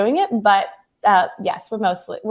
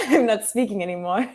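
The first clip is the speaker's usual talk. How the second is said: a playful French accent imitation, laughing; parroted French accent; 2 semitones lower, 3 dB quieter than usual. Guest parrots the French host's accent, laughing. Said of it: "can you parrot Julian's accent".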